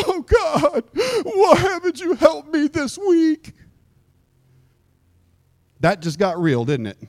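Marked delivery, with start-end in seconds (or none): 0.1-3.5 high, drawn-out tearful plea; mock crying. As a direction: whine it to God as if in tears. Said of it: "come into church crying".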